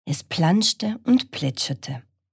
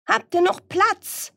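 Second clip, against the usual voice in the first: very high sheep voice; bleating sheep. Acted. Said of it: "blökte das Schaf".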